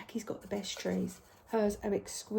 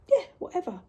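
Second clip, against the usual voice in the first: dismissive; 9 semitones higher, 6 dB louder, faster than usual. Real, not acted. Shrugging off customers' choice.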